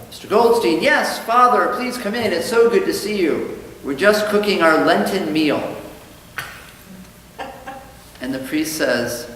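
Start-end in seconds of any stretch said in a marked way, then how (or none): 0.1-5.8 higher and louder, eager nervous host; fawning, anxious welcome; nervous Goldstein. Acted. Nervous Goldstein greeting priest, joke.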